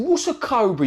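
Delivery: high-pitched mock disbelief; acted-out scornful question; incredulous retort. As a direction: challenge him as if it's nonsense. Acted quarrel with snake charmer.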